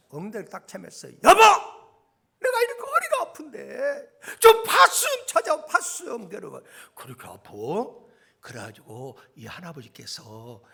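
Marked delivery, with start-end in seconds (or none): 1.1-6.6 high, loud, whining wife in pain; complaining wife. Acted. Acted wife wakes husband, back hurts. 6.9-7.5 low, quiet husband's reply; subdued husband. Acted. Acted drowsy husband answers wife.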